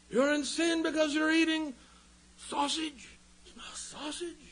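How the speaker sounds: high-pitched mimicry of a scandalised legalist; mock accusation. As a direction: send up a fussy accuser, voice way up. Mock accuser scolding over food.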